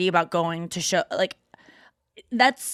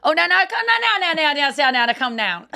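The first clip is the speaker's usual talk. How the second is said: mock fan yelling; 8 semitones higher than usual. Mock fan calling foul ball.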